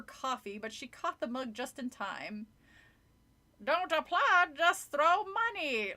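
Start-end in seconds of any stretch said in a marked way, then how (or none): 3.6-5.9 high, slow wisecracking dummy; high cheeky puppet voice. A narrator's reading. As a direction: squeeze it out like a ventriloquist's puppet. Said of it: "Lindy made Slappy say".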